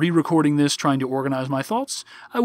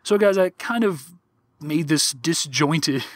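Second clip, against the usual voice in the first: ordinary delivery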